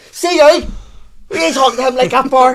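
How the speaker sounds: loud, high, shouted character voice; belligerent alter ego. Pretend. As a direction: burst in as the rowdy brother. Plays alter ego Baldy Paul arriving.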